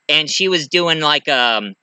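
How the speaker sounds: ordinary talk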